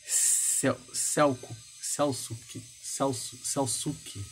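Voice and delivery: softly trying out the name Selçuk; real fumbling attempts at saying Selçuk; unsure pronunciation of Selçuk. Real, not acted. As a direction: sound out Selçuk haltingly, restarting it. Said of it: "esse nome eu não sei pronunciar direito".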